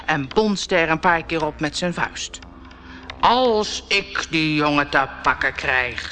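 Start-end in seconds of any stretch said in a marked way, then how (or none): 3.2-6.1 menacing. A narrator's reading. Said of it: "zei hij dreigend".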